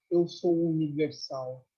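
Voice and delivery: low, slow, serene declaration; unruffled master, retold; Meixo Sama. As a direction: state it calmly, drawing the words out. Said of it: "o que que ele disse?".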